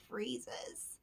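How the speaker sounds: sudden whisper; hushed suspense. A narrator's reading. Whole crowd freezes after Bear's sneeze, hushed whisper.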